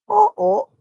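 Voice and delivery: high, playful uh-oh; mock alarm. Real, not acted. Cat reaction.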